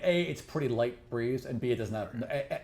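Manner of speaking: usual speaking voice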